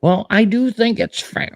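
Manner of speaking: slow, high imitation of Pelosi; a light high-pitched Pelosi impression; imitating Nancy Pelosi. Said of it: "She says".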